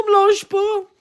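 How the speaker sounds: normal talking voice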